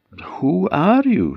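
high, wondering question; puzzled questioning. A narrator's reading. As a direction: ask it high with surprise. Princess questions the mysterious stranger.

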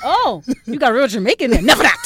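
loud, mock-aggressive retort; big, forceful retold refusal; aggressive outburst. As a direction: imitate an aggressive brother, belting it out. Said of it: "Oh, very aggressive".

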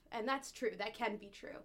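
ordinary talk, nothing marked

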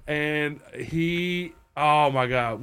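normal talking voice

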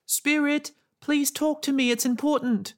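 high, pleading call; calling out loudly. A narrator's reading. Girl calling loudly to the spirit in the grove. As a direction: call out into the dark trees. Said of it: "she called out loudly into the grove".

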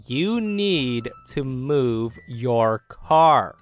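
mock slow, loud and overly careful; exaggerated slow, loud imitation of Karen. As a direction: spell it out very slowly like to a child. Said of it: "speaks very slowly and loudly".

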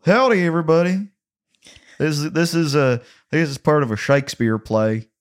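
Southern twang. Acted. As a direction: play up the Missouri twang to a room. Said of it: "speaking with a twang".

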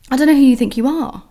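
retold telling-off. To the guy who grabbed her water, a fast loud rebuke. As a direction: tell him off like you mean it.

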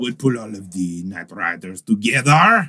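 put-on slow, hammy French villain accent. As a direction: lay on a thick fake French accent. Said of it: "do my French accent".

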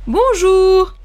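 bonjour lancé haut et fort; salut au collègue. Shown as a demonstration. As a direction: saluer un collègue en arrivant. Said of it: "aux collègues". (dire bonjour aux collègues, plus haut et plus fort)

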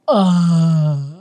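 emotional choked cry; overwhelmed sob. Retold or imitated. Mother first holding her newborn, choked with emotion. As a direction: let the feeling break out.

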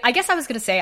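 ordinary talk, nothing marked

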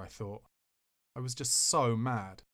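plain reading voice

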